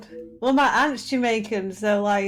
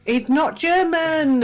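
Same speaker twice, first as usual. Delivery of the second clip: mock exclaim; 5 semitones higher, 4 dB louder, much slower than usual. Joking about a guest.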